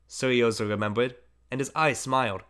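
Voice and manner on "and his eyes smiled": plain reading voice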